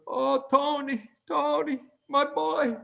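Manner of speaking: high, drawn-out wail of a panicked grandma; pleading, high-pitched imitation of grandma; frightened grandmother. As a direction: imitate an old woman crying out in fear. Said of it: "She was full of fear".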